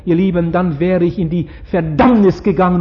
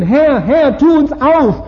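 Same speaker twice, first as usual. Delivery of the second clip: pleading, higher-pitched call; 7 semitones higher, 3 dB louder, slower than usual. Acted. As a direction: cry out to be let in. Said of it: "draußen stehen und rufen".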